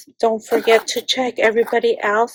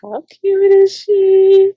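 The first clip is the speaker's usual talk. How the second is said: higher, slow, adoring; drawn-out gush; 8 semitones higher, 3 dB louder, slower than usual. Real, not acted. Vlogger showing a Christmas scene, adoring drawn-out gush. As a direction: stretch it out lovingly. Gushing over a craft gift.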